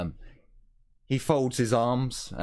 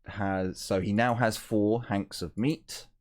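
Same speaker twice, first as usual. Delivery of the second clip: ordinary delivery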